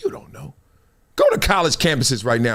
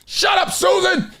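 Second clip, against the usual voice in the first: much higher, drawn-out shout; mock shout; slower than usual. To a lecturing classmate, a high mock shout. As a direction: shout her down in jest.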